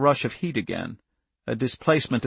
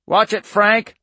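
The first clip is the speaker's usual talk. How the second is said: high, loud warning yell; screamed warning; 9 semitones higher, 6 dB louder, slower than usual. A narrator's reading. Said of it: "Irv Manders screamed".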